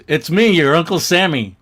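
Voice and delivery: lightly hammed-up entrance line; comic-book character. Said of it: "And he goes".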